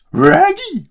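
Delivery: goofy cartoon dog calling his friend. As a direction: bark the name out like a cartoon dog. Cartoon dog impression on stream.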